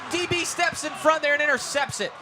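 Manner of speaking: rising shouted fumble call; excited play-by-play. Real, not acted. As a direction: call the turnover at full voice.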